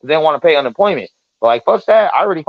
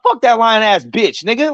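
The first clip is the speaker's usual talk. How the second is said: slow, higher-pitched acted contempt; heated quote, moderately raised pitch; retold angry insult; 6 semitones higher than usual. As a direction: re-enact an indignant retort, drawn out.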